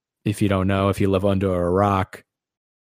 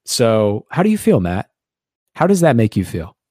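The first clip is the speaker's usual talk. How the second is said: ordinary talk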